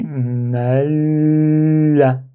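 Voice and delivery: "ma la" with a very long, sustained l; held lam. Shown as a demonstration. Teacher tracing the writing aloud, "ma la" with the l held long. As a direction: stretch the l in "ma la", then release into a. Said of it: "comme si je suis en train de suivre l'écriture".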